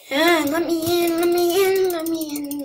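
loud begging; pleading. Real, not acted. Gamer begging to get into the den, loud. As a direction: beg loudly to be let in.

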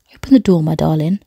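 ordinary delivery, nothing marked

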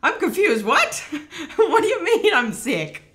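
high, loud, confused laughing; laughing disbelief. Real, not acted. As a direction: cry out baffled through real laughter.

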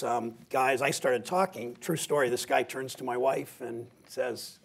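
ordinary talk, nothing marked

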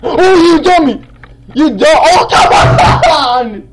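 very high, very loud rage scream; angry screaming. Real, not acted. Gamer who badly wants to win, screaming in anger. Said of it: "back from all this angerness".

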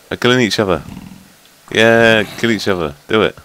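loud gleeful cheer for the fight; loud cheering at the mobs; excited yelling. Real, not acted. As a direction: egg them on, shouting.

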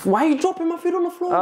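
high-pitched mock complaint; mock outrage. Real, not acted. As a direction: squeal it in playful outrage.

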